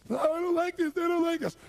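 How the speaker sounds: high, loud imitation of panic; hammed-up panicked whine; freaked-out wail. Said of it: "he's freaked out".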